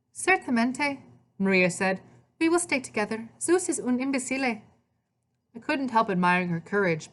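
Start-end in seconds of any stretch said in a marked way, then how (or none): 0.1-1.0 high-pitched Italian woman's voice; Italian accent. A narrator's reading. Maria answering Hades, Italian accent. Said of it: "she spoke with an Italian accent". 2.4-4.6 put-on high, defiant Italian-accented voice. A narrator's reading. Maria to Hades about staying together, Italian accent. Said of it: "she spoke with an Italian accent".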